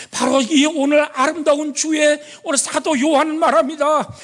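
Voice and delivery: high, fervent preaching voice; climbing, impassioned sermon delivery. Acted. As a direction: lift the congregation with each phrase. Preacher rising into exhortation.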